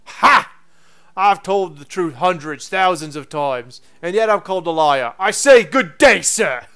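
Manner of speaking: slow, indignant Cockney professor; Cockney accent. Acted. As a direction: build to a loud Cockney dismissal. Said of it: "Now the Cockney accent works".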